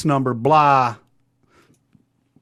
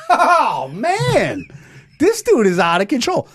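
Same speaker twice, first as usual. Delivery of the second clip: laughing; 7 semitones higher, slower than usual. Retold or imitated. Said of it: "I'm like".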